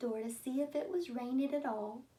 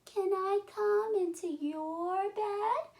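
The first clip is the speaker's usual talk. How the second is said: high squeaky little mouse voice calling out; 9 semitones higher, 6 dB louder, slower than usual. Acted. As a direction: call for help like a tiny scared mouse.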